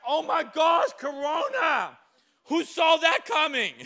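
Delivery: high, loud, laughing shout of mock shock; God's shocked reaction. God panicking about Corona, high laughing shout. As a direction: play God caught off guard, hammed-up. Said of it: "and God's like".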